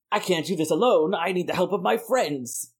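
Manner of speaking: a light, hammed-up heroic Thor impression; lightly put-on god of thunder calling for his friends. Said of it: "do that accent at least two more times".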